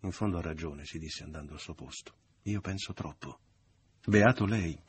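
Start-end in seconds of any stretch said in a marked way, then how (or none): 4.0-4.8 loud, raised sarcastic jab. A narrator's reading. Mother sniping at Leo, sarcastic. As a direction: throw it out with bitter sarcasm. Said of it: "ripeté la madre sarcastica".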